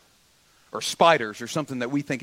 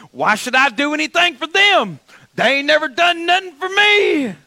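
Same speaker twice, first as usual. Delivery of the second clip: high, loud imitation of a griping poster; whine-shout it like an aggrieved online ranter; mock indignant outburst; 12 semitones higher, 8 dB louder than usual. Voices resentful social media posts.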